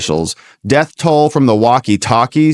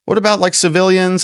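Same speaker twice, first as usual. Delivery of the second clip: high, whiny, put-on voice asking the questions; high mocking impression of the reporters; 10 semitones higher than usual. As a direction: squeal the questions like a pestering reporter. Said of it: "People say".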